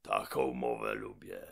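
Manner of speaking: low, heavy robber voice; menacing giant mouth. Acted. Gębon answers the robots, low and heavy. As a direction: boom down from above.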